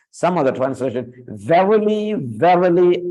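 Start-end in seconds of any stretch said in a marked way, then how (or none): 1.3-3.1 "Verily, verily" both lifted high and stressed; emphatic repetition. Shown as a demonstration. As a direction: push each "verily" up for weight. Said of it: "it is for emphasis".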